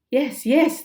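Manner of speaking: loud, eager yes; shouted. Acted. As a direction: shout it together, giving up. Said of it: "they shouted".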